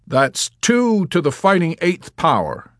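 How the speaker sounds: loud, high and slow; emphatic patient. Acted. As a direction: call it out high, drawing it out. Yossarian voiced in acted dialogue.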